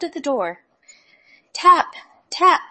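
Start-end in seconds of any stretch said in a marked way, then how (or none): 1.5-2.6 high, loud knock imitation; knocking sound. A narrator's reading. Wolf knocks at the door.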